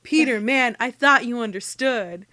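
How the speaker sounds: loud, hurt protest; betrayed and scandalized. Retold or imitated. Dean appealing to Peter about the whiskey, hurt. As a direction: plead like a friend betrayed. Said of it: "Dean is scandalized".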